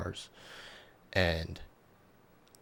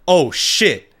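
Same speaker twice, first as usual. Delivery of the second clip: higher, louder startled curse; alarmed oh shit; 8 semitones higher, 9 dB louder than usual. Retold or imitated. He sees her run off with the knife, higher and louder alarm. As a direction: caught off guard as she bolts.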